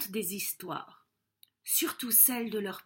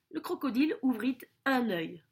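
ordinary delivery

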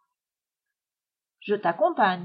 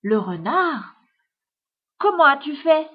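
dumbfounded father, growing louder; stupefied; 3 semitones higher, 5 dB louder than usual. A narrator's reading. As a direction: ask it in utter amazement. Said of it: "regarde son fils stupéfait".

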